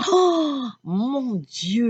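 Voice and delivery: a high gasp of dismay; Oh rises sharply, then drops back; shocked exclamation. Retold or imitated. Recalling the ER waiting room, a shocked high exclamation.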